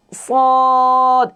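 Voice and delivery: সদ held long and high, a full sustained vowel; correct recitation. Shown as a demonstration. Teacher modeling the right sound for students. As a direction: sing out সদ slowly like Quran recitation. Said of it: "আবার আমার সাথে বলেন".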